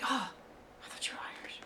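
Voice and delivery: tiny whispered let-down; hushed, crestfallen retold reply; deflated whisper. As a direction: shrink to a barely audible, acted disappointment. Voices a let-down character's reply.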